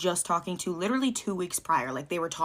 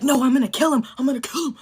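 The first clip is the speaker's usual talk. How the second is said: mock enraged yell; 5 semitones higher, 6 dB louder than usual. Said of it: "He starts screaming on the top of his lungs".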